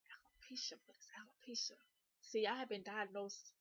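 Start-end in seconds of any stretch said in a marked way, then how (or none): 0.1-1.8 whispered, baffled question; quiet re-enacted disbelief; hushed puzzlement. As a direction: softly try out an unknown word in confusion. Acts out puzzled reaction to diagnosis.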